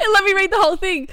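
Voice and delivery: high, breathless after a laughing fit; after laughing hard. Real, not acted. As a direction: still catching breath from laughter. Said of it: "I've got the giggles".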